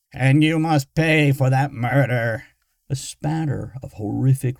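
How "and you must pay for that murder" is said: louder, squawking machine voice; jittery squawk. Acted. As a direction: jitter every syllable like a broken box. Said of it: "its voice jittering with syllable".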